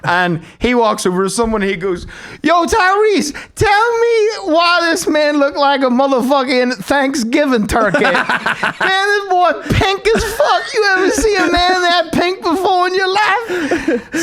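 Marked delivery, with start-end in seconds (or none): 2.4-8.0 imitated coach's holler, pitched high; calling out mockingly. Coach calling someone over to mock trainee, high incredulous holler. 8.0-14.2 high, hammed-up disbelief through laughter; incredulous mockery. Coach ridiculing trainee to a bystander, high-pitched incredulous mockery.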